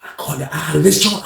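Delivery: usual speaking voice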